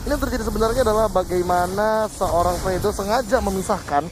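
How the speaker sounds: ordinary delivery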